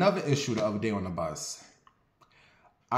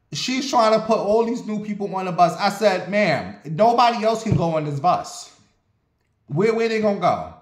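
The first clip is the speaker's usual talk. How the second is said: raised, higher and louder exasperation; loud complaint; 7 semitones higher, 5 dB louder than usual. Retold or imitated. Passenger telling the driver no one else fits, loud and exasperated. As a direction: push back hard on the driver. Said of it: "was I the damn spokesperson for the bus today".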